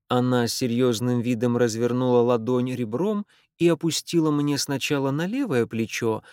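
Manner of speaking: ordinary delivery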